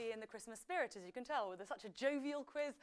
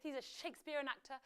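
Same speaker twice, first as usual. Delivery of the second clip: ordinary delivery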